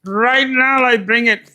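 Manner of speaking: calm him down fast, nervous; placating girlfriend. Pretend. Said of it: "girlfriend placating dude".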